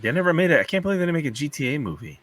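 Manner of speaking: normal talking voice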